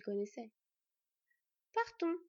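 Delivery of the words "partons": higher, hard command; icy fox girl ordering, hard; harsh. Acted. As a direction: cut the farewell short. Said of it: "Le ton employé par la renarde au cœur de glace était dur".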